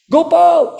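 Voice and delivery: loud, high call of the name. Retold or imitated. Thief calling for Gopal again, a loud high cry. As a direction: shout the name eagerly into the woods.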